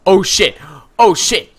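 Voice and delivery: loud, high frightened chanting; panicked streamer. Real, not acted. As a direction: babble while running away. Fleeing monster again.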